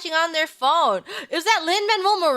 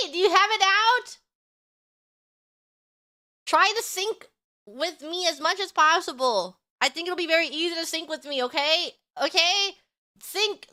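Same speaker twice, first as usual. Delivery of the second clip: normal talking voice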